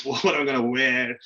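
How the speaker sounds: ordinary delivery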